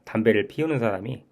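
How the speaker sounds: normal talking voice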